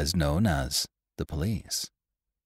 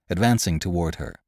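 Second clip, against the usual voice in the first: ordinary delivery, nothing marked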